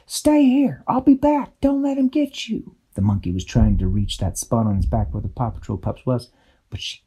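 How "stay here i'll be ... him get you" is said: high, urgent fairy voice; fairy giving orders. A narrator's reading. Tweak telling the pups to stay put, urgent. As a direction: tell the pups to hold on, firmly. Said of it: "She said to the Paw Patrol pups".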